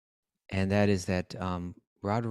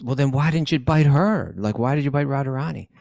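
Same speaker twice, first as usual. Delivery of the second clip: loud, raised-pitch protest; mock indignant; 5 semitones higher, 8 dB louder than usual. Krishna challenges the snake over its victim, loud mock indignation. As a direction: demand an answer loudly, voice pushed up.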